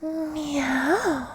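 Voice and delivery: high kitten meow; cat girl meow. Acted. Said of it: "some cat girl A S M R".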